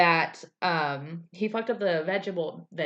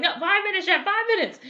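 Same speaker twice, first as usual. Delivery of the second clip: very high, louder flustered answer; panicky Elsie; 14 semitones higher, 6 dB louder than usual. Retold or imitated. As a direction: squeak it out in a rising panic.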